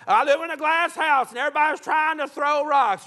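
a hint of put-on high whining; mock complainer. Mimics a complaining church leader.

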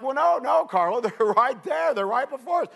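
high, laughing protest; no no, they're right there, up high; insistent excitement. Retold or imitated. Groom arguing with his wife that the mountains are close, high and insistent. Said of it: "I'm like".

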